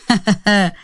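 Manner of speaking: villain's scornful chuckle; gloating acted laugh; mocking laugh. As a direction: laugh off the threat. Villain laughs off her threat.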